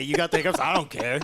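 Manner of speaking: laughing. Real, not acted. Mock indifference to partner's hiccups.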